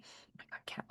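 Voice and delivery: barely audible real exclamation at the cat; hushed aside. Real, not acted. Poet interrupted by a cat, hushed aside. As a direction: drop right under your breath.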